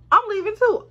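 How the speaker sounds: high, loud I'm leaving too; emphatic declaration. Real, not acted. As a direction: announce it defiantly. Declaring she left.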